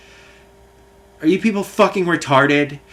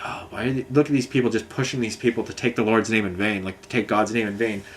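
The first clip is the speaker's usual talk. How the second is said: low, hushed grumble under the breath; quiet disapproving murmur; muttered aside; 4 semitones lower, 6 dB quieter than usual. Retold or imitated. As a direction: mutter it to yourself from the back of the line. Muttering in the background at bank.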